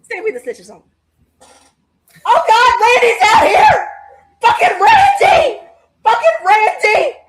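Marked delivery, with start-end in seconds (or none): 2.2-7.2 loud high shouting at the screen; shouting Randy's name; excited yelling. Real, not acted. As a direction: yell at the wrestler on TV. Yelling at wrestling on TV.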